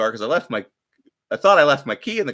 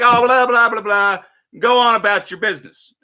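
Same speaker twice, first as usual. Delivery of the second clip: high and loud, waving her away; mock shooing her off; loud dismissive brush-off; 8 semitones higher, 5 dB louder than usual. Dismissing the lady in the parking lot, loud brush-off. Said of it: "So I was like".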